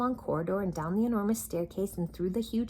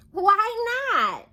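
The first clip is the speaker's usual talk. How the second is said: much higher, loud and bright; chuckling question; 9 dB louder than usual. Acted. Little Miss Sunshine chuckling at the king. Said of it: "she chuckled".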